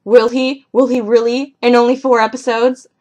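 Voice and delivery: mock-doubtful reading of notes; sceptical. Real, not acted. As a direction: scoff at the idea. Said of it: "can you read that".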